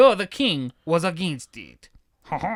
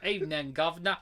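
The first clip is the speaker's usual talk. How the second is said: Cockney accent; 2 semitones lower, 9 dB quieter, faster than usual. Shown as a demonstration. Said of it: "Cockney accent".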